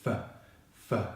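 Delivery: isolated "F, f", just blown air; unvoiced F. Shown as a demonstration. Teacher showing how to make the sound, unvoiced "F". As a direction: blow "F, f" through teeth on lip, voiceless. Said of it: "blowing like so".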